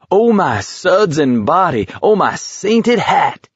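high, drawn-out theatrical lament; giddy, swooping mock horror; mock exclamation. A narrator's reading. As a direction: wail it in hammed-up pious shock. Giggling man keeps up taunting.

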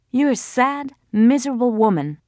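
high, cutting insult; bitter insult. A narrator's reading. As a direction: hurl it at her. Laila insults Mariam.

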